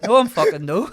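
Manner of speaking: comic Scottish denial over laughter; Scottish accent. Retold or imitated. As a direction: mimic an indignant Scottish reply. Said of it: "do you ever see that Scottish video".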